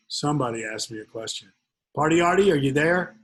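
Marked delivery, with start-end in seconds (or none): 1.9-3.2 calling out. Real, not acted. Calling out to a listener.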